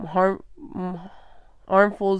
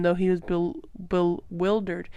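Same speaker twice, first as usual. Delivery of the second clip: plain reading voice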